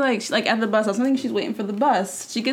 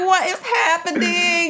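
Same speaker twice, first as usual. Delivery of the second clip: slow laughing question, higher; laughing disbelief; 8 semitones higher, slower than usual. Real, not acted. Friend retelling the fight, laughing in disbelief. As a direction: ask it through real laughter. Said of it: "laughing retelling".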